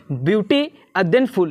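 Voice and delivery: slow "beauty", break, then "full"; broken into two parts. Shown as a demonstration. Teacher showing students the word takes two goes, broken in two. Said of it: "दो बार में pronounce कर पाते".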